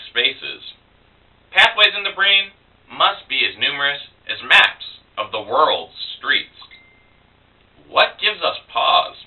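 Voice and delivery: measured poem recital with drawn-out phrases and pauses; spoken verse, lingering line by line; poetry reading. Acted. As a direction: read it slowly, lifting the pitch on opening words. Poet reads own poem.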